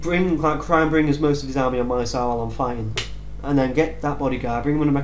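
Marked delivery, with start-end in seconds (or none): none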